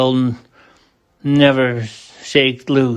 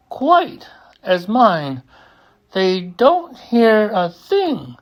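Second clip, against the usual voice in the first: higher, anxious elephant voice pressing the mayor; Horton urging; 7 semitones higher than usual. A narrator's reading. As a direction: push the mayor, worried and insistent, higher.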